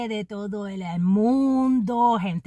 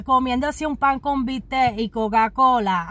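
ordinary talk, nothing marked

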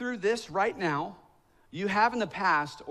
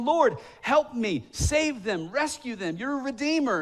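desperate prayer; 7 semitones higher, 4 dB louder than usual. Retold or imitated. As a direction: plead with God for lost kids. Desperate prayer for lost family.

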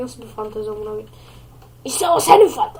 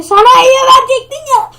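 put-on high, loud child yell at dad; a boy protesting loudly; kid shouting; 8 semitones higher, 11 dB louder than usual. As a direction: son yelling a reminder at his father.

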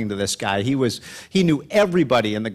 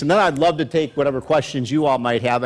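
usual speaking voice

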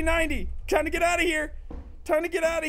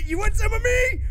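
worked-up taunt; 7 semitones higher, 4 dB louder than usual. Real, not acted. Psyching self up against enemy.

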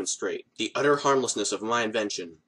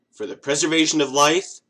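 normal talking voice

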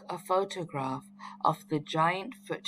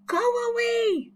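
high, loud yell to scare it off; girl chasing off a dinosaur, high yell; 4 dB louder than usual. A narrator's reading. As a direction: shout it away with a stick raised. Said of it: "she yelled".

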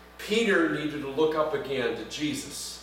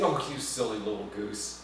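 soft, lower, fond; affectionate teasing; 8 semitones lower, 7 dB quieter than usual. Retold or imitated. Parent ruffling a child's hair, soft and fond. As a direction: tease a child lovingly. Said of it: "with a big smile on his face".

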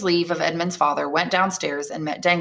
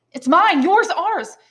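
high, loud, exuberant outburst; joyful; 12 semitones higher, 6 dB louder, slower than usual. Acted. Son shares gold with father, joyful outburst. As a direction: build it up happily word by word.

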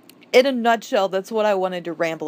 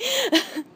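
high laugh. Real, not acted. Exasperated with Misaki.

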